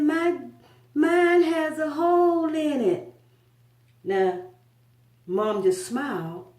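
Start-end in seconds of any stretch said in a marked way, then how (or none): 0.0-3.1 put-on high, slow, upset child; boy whining to his mom about the donut; little boy's voice. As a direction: play the small boy, high and hurt. Crying boy voiced in story.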